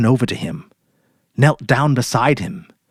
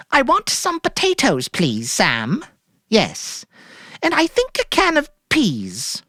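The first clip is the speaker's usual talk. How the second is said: much higher, put-on lightness; forced cheerful rehearsal; 13 semitones higher, slower than usual. A narrator's reading. Mary rehearsing her shopping order, falsely cheerful. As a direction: sound breezy and normal. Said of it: "She rehearsed it several more times".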